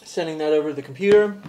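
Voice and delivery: ordinary talk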